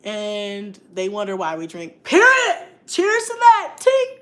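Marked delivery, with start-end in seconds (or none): 2.0-4.2 higher, louder, clinking glasses; excited toast. Real, not acted. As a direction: cheer it out to the chat. Toasting with live viewers.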